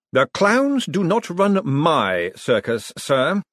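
measured reprimand from a ruler; commanding Vetinari. A narrator's reading. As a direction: pronounce it slowly and deliberately, laying down the law.

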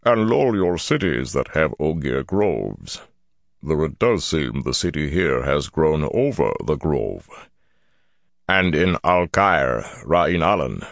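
slow, gravelly elder voice, trailing off at the end; ponderous Ogier elder. A narrator's reading. Ogier elder recalling cities with groves, slow gravelly voice trailing off. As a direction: list them slowly and let it fade.

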